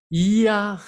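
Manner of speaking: "Ya" as "ee-a", high and loud; I plus A. Shown as a demonstration. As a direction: stretch "Ya" into a clear ee gliding to a. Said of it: "La I con la A".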